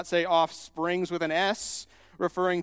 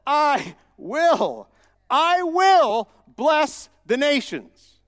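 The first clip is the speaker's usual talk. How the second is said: loud, high, building preacher's climax; fiery sermon peak, voice climbing with every line; preaching at full force; 6 semitones higher, 7 dB louder than usual. Acted. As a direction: hammer each I will home from the pulpit.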